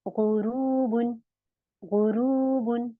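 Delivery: गुरूबुन slow, as throaty ghu-rooo-bun; heavy ghain. Shown as a demonstration. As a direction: stretch the oo in गुरूबुन after thick gh. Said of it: "इसी तरीके से".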